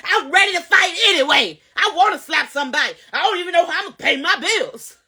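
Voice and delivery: high mock rant; hammed-up angry venting, pitched high; acting ready to fight. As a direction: play your old worked-up self, itching to fight. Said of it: "I was ready for it".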